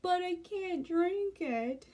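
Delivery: high, slow whiny "But I can't drink it"; drunk whine. Retold or imitated. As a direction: whimper it out like a drunk man refusing. Drunk husband whining refusal.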